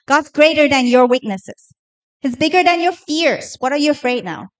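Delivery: ordinary delivery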